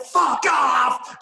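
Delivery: high, loud shouted fuck off; shouting at the man as the lift shuts; retold shout. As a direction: bark it as the door closes. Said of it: "I went".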